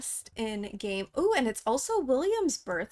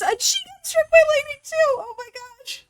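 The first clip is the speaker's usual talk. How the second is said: high, loud shock fading to a breath; shocked squeal; 14 semitones higher, 7 dB louder than usual. Real, not acted. Gamer reacting as her heir is struck by lightning. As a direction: squeal in disbelief at a second strike.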